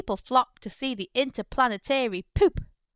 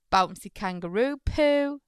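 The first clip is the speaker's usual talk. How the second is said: plain reading voice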